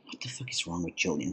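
fast, breathy whispered disbelief; stunned whisper. Retold or imitated. Narrator thinking about Killian's odd behaviour, shocked whisper. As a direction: whisper it like you can't believe it. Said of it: "And I'm like".

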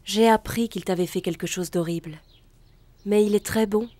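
ordinary delivery

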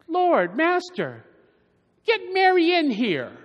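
a hammed-up, put-upon hostess complaining; irritated Martha. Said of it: "she starts to get irritated".

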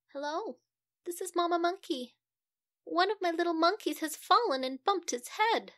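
a frazzled mum ringing for help; fretful Mamma Monkey. Acted.

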